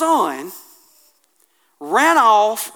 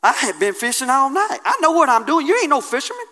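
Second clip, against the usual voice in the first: lightly voiced annoyed fisherman; a hint of a proud fisherman arguing; Peter protesting; 4 semitones higher than usual. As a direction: push back on Jesus a bit indignant. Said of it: "He said".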